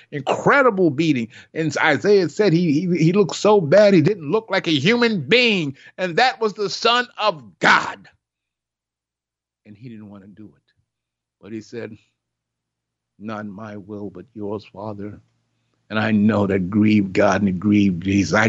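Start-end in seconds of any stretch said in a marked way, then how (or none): 0.1-8.1 preacher climbing to a climax; rising preaching. Acted. 9.6-15.2 much softer and lower; preacher quietly voicing Jesus's surrender; hushed.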